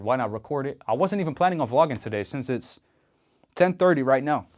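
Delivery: ordinary talk, nothing marked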